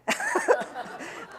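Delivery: high, breathy burst of laughter; real laugh. Real, not acted. Speaker recalling being told to take a hike, amused laugh. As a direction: laugh helplessly at your own story. Laughs at her own retold retort.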